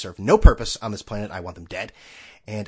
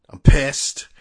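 imitating; 9 dB louder than usual. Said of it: "the interview also starts with".

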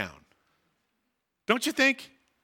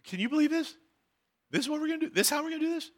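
a hint of a doubting guy, higher and softer; mock skeptic; 7 semitones higher, 5 dB quieter, much faster than usual. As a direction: lightly voice the grumbling guy in the group. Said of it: "He says".

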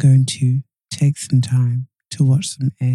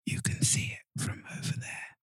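whispering; 20 dB quieter than usual. Acted. Responding in ASMR whisper.

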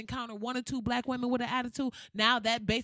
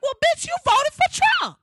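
much higher, much louder gloating shout; trump card yell; 13 dB louder than usual. Pretend. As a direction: scream it as the final winning blow. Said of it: "I'm gonna be like".

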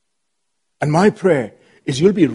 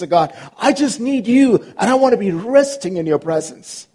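fervent prayer; 7 semitones higher than usual. Acted. Fervent prayer voiced high.

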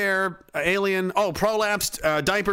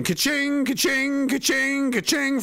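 cash register ka-ching; 8 semitones higher than usual. Pretend.

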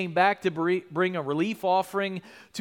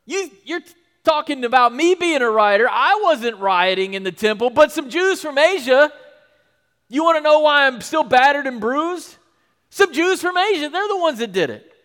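much higher and louder, indignant; Paul outraged; 8 semitones higher, 8 dB louder than usual. Retold or imitated. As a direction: roar out the grievance.

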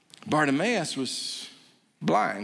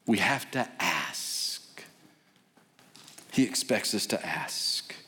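quiet emphasis; 9 semitones lower, 6 dB quieter than usual. Real, not acted. Pastor pressing the point home to listeners, hushed. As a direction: drop low and soft, slow on the point. Soft emphatic sermon close.

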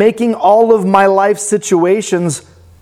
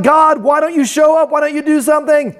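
higher, pleading, the second line rushed; frustrated plea; 8 semitones higher, faster than usual. Retold or imitated. Preacher retelling his panicked prayer to God, exasperated.

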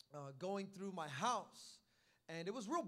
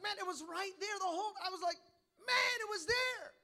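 disbelieving groan; 9 semitones higher, 5 dB louder than usual. Retold or imitated. Retold frustration at himself.

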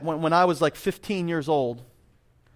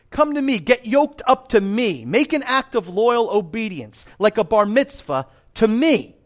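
higher, ringing appeal, mostly slow; emphatic quote reading; 5 semitones higher, 4 dB louder, slower than usual. Acted. Preacher voices the carpenter calling people to him, emphatic quote reading. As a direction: build into an insistent, stretched-out summons.

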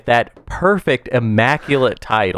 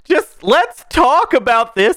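mock indignant; 13 semitones higher, slower than usual.

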